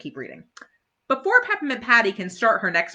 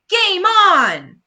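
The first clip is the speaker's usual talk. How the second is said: much higher, loud battle call; excited cry; 12 semitones higher, 10 dB louder than usual. Acted. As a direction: kick off the game. Start of the hockey game.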